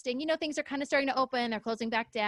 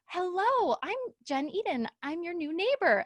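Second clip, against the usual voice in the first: eager greeting; 7 semitones higher than usual. Retold or imitated.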